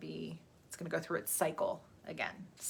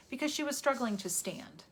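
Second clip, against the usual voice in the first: ordinary talk, nothing marked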